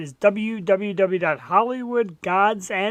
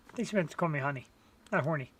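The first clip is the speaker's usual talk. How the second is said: ordinary delivery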